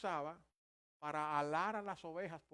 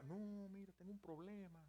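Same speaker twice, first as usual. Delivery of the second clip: softer, slightly raised excuse-making voice; reluctant excuse; 5 semitones higher, 8 dB quieter than usual. Acted. Fair-weather friend dodging a call for help, reluctant excuse. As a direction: beg off apologetically.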